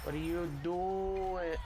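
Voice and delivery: drawn-out, high, slow disbelief; incredulous. Real, not acted. Gamer asking his teammate, slow and baffled.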